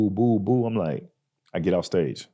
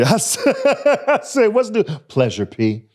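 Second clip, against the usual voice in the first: laughing high and loud through the words; 5 dB louder than usual. Real, not acted. Laughing at a friend who named his favorite artist. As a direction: crack up while blurting it out. Laughing while recalling the name.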